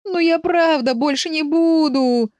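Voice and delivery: high, slow whine; whimpering. A narrator's reading. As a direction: whine a drawn-out, pleading promise. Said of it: "и проскулил". (жалобно скуля)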